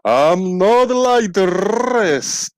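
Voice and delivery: high, slow put-on frog voice; slow and lofty, like a proud frog; intellectual frog voice. Frog character speaks.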